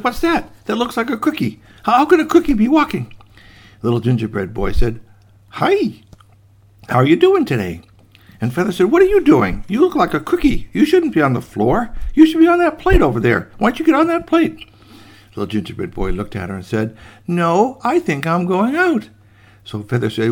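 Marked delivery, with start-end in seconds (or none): 0.0-3.1 high-pitched disbelief; baffled astonishment. Acted. Daughter baffled by walking cookie. 5.5-7.8 squeaky hi, then friendly chat; cheery gingerbread boy. Acted. Gingerbread boy greets Feather. 8.9-14.6 high, insistent telling-off; indignant scolding. Acted. Feather scolds the cookie. 17.3-19.1 high, drawn-out little refusal; cheeky gingerbread boy. Acted.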